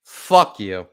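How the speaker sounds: loud, furious swear at the screen; angry outburst. Real, not acted. Fan cursing the refs after a call, loud angry outburst.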